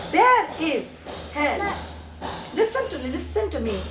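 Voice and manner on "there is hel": "There is" slowly, each word set apart. Shown as a demonstration. Teacher has child say the sentence, word by word. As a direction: model "There is" in slow separate beats. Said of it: "now say the sentence".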